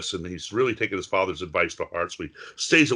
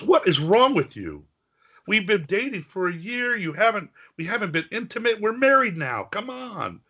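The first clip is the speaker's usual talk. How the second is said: higher-pitched mock indignation; exasperated wife; 11 semitones higher than usual. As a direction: plead in frustrated disbelief, pitched up. Wife argues with reluctant husband.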